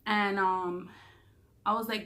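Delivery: ordinary delivery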